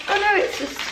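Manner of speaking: a bright little gasp of surprise; sudden realization. Real, not acted. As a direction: pitch jumps up on the oh no. Realizing what the packet holds.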